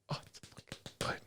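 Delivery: barely voiced, hushed reaction; awed whisper. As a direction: whisper it as a retold reaction, overcome. Retold reaction to moving ending.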